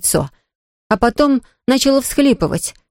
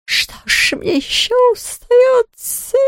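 wailing; much slower than usual. Acted. Ghost bemoaning its fate.

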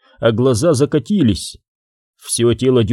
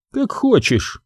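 sharp high retort; snapping; 10 semitones higher, slower than usual. Acted. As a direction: snap it back, fed up. Zahara's patience snaps. (резко, с раздражением)

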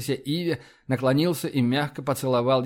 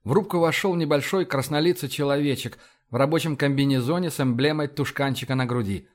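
ordinary delivery, nothing marked

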